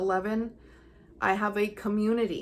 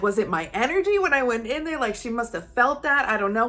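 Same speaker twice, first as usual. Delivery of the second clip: higher and louder, amazed and wondering; thrilled disbelief; 4 semitones higher, 5 dB louder than usual. Retold or imitated. After the landlady's approval call, thrilled and wondering. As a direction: marvel out loud at your luck.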